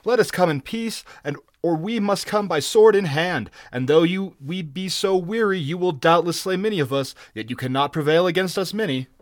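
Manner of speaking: insistent clamoring. A narrator's reading. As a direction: press your case urgently. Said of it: "clamored a lean Shemite".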